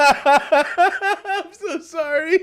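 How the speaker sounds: very high, drawn-out words through laughter; squeaky real cracking-up, slow and breaking; helpless laughing apology. Real, not acted. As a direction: laugh until nearly crying while saying sorry.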